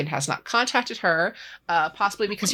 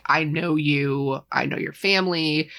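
慢慢地、一字一顿地说“I know you, I know your family”; emphatic; 2 semitones lower than usual. Retold or imitated. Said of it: "specifically emphasizes it".

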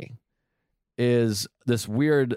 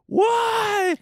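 put-on very high-pitched childlike wail; mock crying wail; 4 dB louder than usual. Podcaster acting out how a late train feels inside, mock crying wail. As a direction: burst into hammed-up bawling. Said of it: "I wanna cry".

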